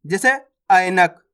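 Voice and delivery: usual speaking voice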